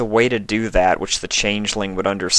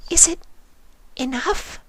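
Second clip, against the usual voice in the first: high, hesitant and unsure; faltering Fluttershy; 3 dB quieter than usual. A narrator's reading. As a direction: let the question waver timidly. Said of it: "Fluttershy faltered".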